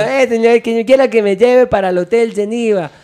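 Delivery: high, loud mock Peruvian accent. Shown as a demonstration. Passenger asks for his hotel, loud imitated Peruvian accent. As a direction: pitch it up as a hammed-up Peruvian. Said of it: "Como peruano".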